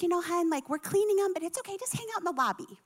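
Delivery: high, soft, sweet hostess voice; hotel desk gal. Retold or imitated. Desk gal to stranded guests, gently reassuring. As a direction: soothe them in a light high voice.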